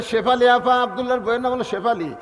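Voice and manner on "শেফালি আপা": calling out. Acted. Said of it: "এসে ডাকতেছে".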